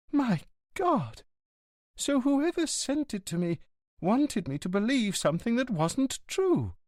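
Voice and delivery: high signora voice in dismay; distressed signora. Acted. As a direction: lift into the wife's voice, shaken.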